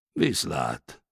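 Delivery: low, understated grumble; lightly grumbling, dropped in pitch; grumbled goodbye. A narrator's reading. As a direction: mumble a curt farewell. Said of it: "morogta doktor Nemeshanyi".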